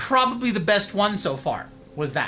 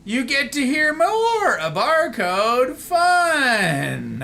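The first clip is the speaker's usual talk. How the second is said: slow, stretched-out words, higher in pitch; playful drawn-out announcement; 5 semitones higher, much slower than usual. Pretend. Streamer announcing another voice recording, playfully drawn out. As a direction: hold the vowels long.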